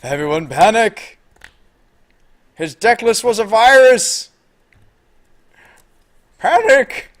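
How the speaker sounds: high, loud, drawn-out alarm call; mock panic. Streamer warns chat of a virus, mock panic. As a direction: sound a hammed-up virus alarm.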